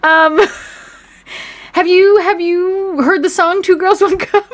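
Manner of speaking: high, loud, over-eager interviewer; hammed-up high reporter voice, barely holding in laughter; mock red-carpet reporter. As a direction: pretend to flag down a star for a prank question. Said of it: "if you're on the red carpet or whatever, you're like".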